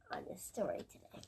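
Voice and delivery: hushed, fast whisper. Real, not acted. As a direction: whisper it quickly. Child whispers to storyteller.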